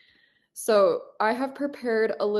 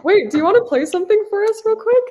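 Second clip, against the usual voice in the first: excited; 10 semitones higher, 10 dB louder, faster than usual. Real, not acted. Eagerly asks for a song.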